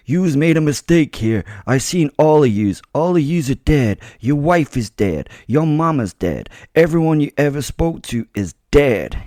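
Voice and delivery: a Jersey mobster threatening the torturer's family; threatening New Jersey accent. Acted. New Jersey gangster accent, menacing threats. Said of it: "a thick accent".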